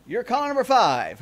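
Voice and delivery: excited caller countdown; radio contest promo. Acted. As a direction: DJ calling out caller numbers.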